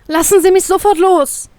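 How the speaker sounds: high-pitched, urgent demand; panicked. A narrator's reading. Captive in the captain's grip, panicking. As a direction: cry out to be released at once. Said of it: "Nun steigt Panik in mir auf".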